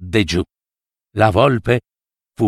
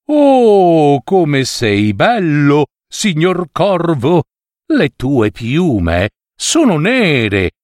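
sly flattering fox; 3 semitones higher than usual. Acted. Fox flattering the crow on the branch, sly and sugary.

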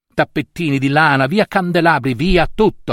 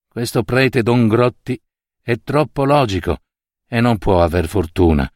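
ordinary delivery

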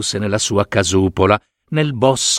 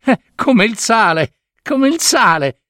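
high, sneering mockery; spiteful sisters mocking the salt answer, high sneer; 12 semitones higher than usual. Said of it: "saltarono su le altre sorelle".

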